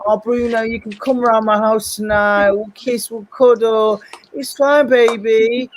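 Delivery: high, slow, mock-sweet comforting girl voice; mocking girl mates. Mocking the girl mates soothing Bruno, high mock-sweet coo. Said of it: "her girl mates like".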